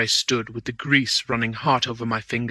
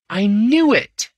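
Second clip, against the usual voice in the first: high, loud and slow; triumphant outburst; 10 semitones higher, 7 dB louder, slower than usual. A narrator's reading. Manager realising who robbed him, triumphant outburst. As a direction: crow it out.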